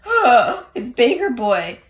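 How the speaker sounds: surprised. Retold or imitated. Group realizes surprising reveal.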